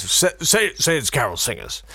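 lightly voiced Boris; a hint of Boris Johnson at the door; mock Boris Johnson. Host mimicking the PM's spoof, lightly voiced. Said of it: "and he says".

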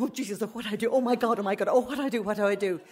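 hammed-up fretting, one plea tumbling into the next; panicked muttering to self. Storyteller relives being stuck in the car park, panicked.

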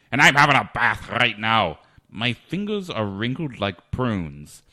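slow, put-on Scottish accent. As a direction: roll it out in a mock Scottish accent. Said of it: "then a Scottish accent".

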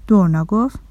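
usual speaking voice

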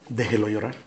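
low, soft delivery of blunt advice; hushed answer. Real, not acted. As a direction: drop the voice right down for the answer. Hushed answer to own question.

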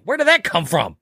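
high-pitched mock disbelief; acted bafflement. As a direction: play baffled at a sudden find. Acting out baffled discovery.

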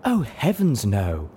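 airy, ladylike dismissal; chiming in. A narrator's reading. Unicorn joins the argument against the zebra, chiming in. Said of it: "Velvet Remedy chimed in".

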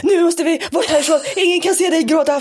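mock his frantic hurry; stressed boyfriend. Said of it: "han är så här". (pojkvännen i mild panik)

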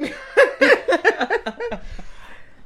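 high, loud burst of laughter. Real, not acted. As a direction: crack up loudly. Laughs at joke.

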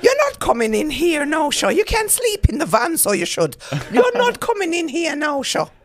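high, loud, scolding mock-Irish; indignant Irish accent, badly faked; attempted Irish accent. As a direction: tell them off in a bad Irish accent. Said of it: "now it's going into the Jamaican".

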